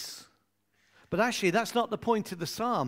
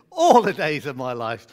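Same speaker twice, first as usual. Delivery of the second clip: All the days of my life. said louder; group response; 4 semitones lower, 9 dB louder than usual. Acted. Congregation answering the preacher, louder. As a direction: call the response out.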